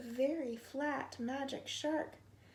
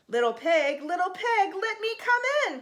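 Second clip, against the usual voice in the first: loud, pleading call of a hungry shark; the hungry shark calls anxiously at the door; 5 semitones higher, 8 dB louder than usual. A narrator's reading. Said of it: "called the hot and hungry magic shark anxiously".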